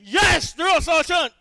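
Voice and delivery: high, loud recruit shout; barked military recruit reply. Acted. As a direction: shout back at the sergeant.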